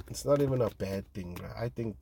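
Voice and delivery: ordinary talk, nothing marked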